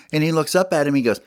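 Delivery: ordinary delivery, nothing marked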